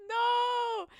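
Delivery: shout. Retold or imitated. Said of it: "so I was like".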